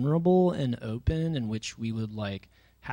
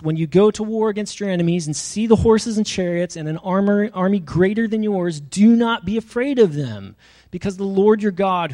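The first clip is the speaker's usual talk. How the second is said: louder and higher, a preacher's full-force charge; forceful preaching; 5 semitones higher, 7 dB louder than usual. Acted. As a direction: proclaim it like a battle command. Moses' dying charge, read out.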